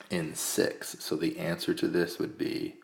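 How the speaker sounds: ordinary delivery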